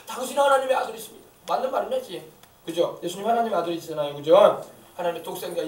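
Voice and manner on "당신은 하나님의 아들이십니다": high, loud acted cry; demons crying out. Prostrate demon addressing Jesus, crying out loudly. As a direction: cry it out, raised in pitch. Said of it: "엎드려가지고 막 그러는 거야".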